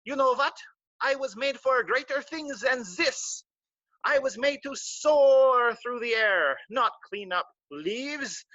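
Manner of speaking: a little squirrel dreaming big, slowly; Jean-Claude the flying squirrel. Acted. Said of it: "he's like".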